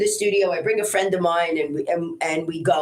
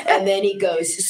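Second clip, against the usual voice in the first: usual speaking voice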